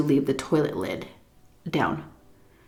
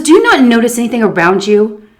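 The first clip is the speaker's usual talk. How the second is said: much louder, exasperated reproach; exasperated scolding; 5 semitones higher, 9 dB louder than usual. Retold or imitated. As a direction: berate her for not noticing. Old lady scolding her.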